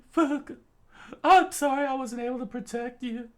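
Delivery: put-on apologetic girl. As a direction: apologize for failing. Character voiced high.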